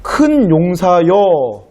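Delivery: slow, drawn-out proclamation; angel's address. Acted. Angel hailing Gideon as he hides, drawn-out address. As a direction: call out a title, stretching every syllable. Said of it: "이렇게 말을 하는 거예요".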